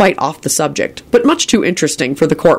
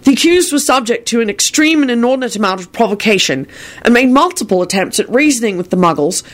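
higher-pitched, determined defense speech; 7 semitones higher than usual. A narrator's reading. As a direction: argue the case with resolve. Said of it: "Harry said determinedly".

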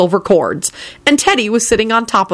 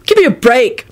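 higher-pitched, exasperated protest; disgusted bark; 6 semitones higher, 3 dB louder than usual. A narrator's reading. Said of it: "George barked out disgustedly".

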